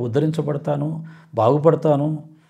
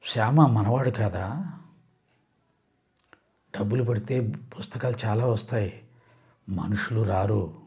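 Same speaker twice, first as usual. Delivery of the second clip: slow, soft, calm admonishing voice; Baba; 3 dB quieter, slower than usual. Acted. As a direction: counsel gently and unhurriedly, with long pauses. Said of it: "అప్పుడు బాబా అంటాడు". (నెమ్మదిగా, మృదువుగా నచ్చజెప్పే స్వరం)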